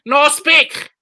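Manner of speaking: high, loud mock scream of broken English. Comic screaming back at a heckler, high and loud. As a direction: yell it back like you can't understand. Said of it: "I just scream at them".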